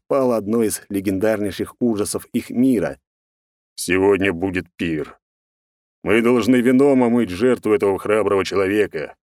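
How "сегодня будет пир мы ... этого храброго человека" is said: commanding proclamation. A narrator's reading. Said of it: "озвучил всем свою волю Мозов".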